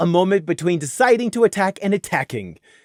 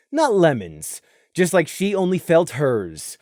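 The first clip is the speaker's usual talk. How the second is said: plain reading voice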